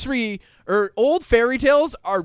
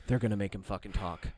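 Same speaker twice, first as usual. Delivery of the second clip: much lower, much softer grim mutter; dreading mutter; 11 semitones lower, 11 dB quieter than usual. Retold or imitated. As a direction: mutter it under your breath. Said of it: "I was like".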